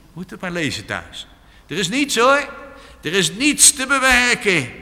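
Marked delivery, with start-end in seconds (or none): 1.7-4.7 much higher and louder call; servant calling out. Retold or imitated. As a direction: shout the news from the hilltop. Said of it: "En zijn knecht maar roepen".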